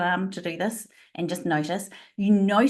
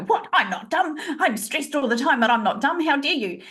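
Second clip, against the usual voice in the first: higher, hammed-up offended protest; mock indignation; 6 semitones higher than usual. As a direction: play the insulted workshop guest, half laughing. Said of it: "people go".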